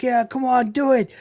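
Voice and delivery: root for him like a crowd; mock crowd cheer. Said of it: "everyone's cheering again".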